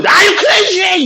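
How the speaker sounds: high, drawn-out outraged yell; outraged shout. Shown as a demonstration. Teacher showing the right way to say it, outraged shout. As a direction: hurl CRAZY out slowly at a high pitch. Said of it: "이래야 돼".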